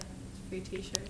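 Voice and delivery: low, very soft mumble; slow, barely voiced mock admission; muttered reply. Sheepish reply in retold scene.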